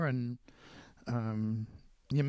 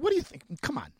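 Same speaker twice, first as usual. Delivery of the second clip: exasperated retort; 8 semitones higher, 4 dB louder, faster than usual. Retold or imitated. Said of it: "He said".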